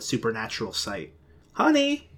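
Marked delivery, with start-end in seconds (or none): 1.5-2.1 high calling-out from another room; high mock-mom yell. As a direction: call from the kitchen. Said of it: "her mom yelled from the kitchen".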